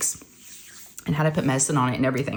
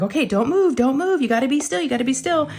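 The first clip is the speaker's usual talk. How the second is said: high-pitched, insistent repeated urging; retold urgent instruction; 8 semitones higher than usual. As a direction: play a doctor urging a child to freeze. Doctor urging child to hold still.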